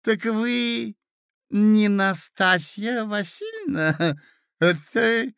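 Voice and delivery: put-on high, slow, giggling old man; doddering old prince. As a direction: ramble cheerfully like a senile prince. Prince rambling on.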